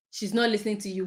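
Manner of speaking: usual speaking voice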